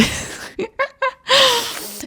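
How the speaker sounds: breathy burst of laughter; helpless little giggle trailing into breath; amused laugh. Real, not acted. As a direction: crack up at a cheeky line. Laughs at the cheeky card.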